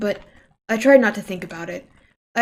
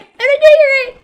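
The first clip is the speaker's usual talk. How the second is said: much higher and louder, strained; high girl voice; 10 dB louder than usual. Shown as a demonstration. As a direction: push it up into a high loud voice. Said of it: "I'm not doing her voice right".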